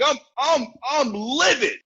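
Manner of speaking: high, loud, hammed-up fury; livid. Shown as a demonstration. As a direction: blow up in demonstrative rage. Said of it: "Be angry. Be like".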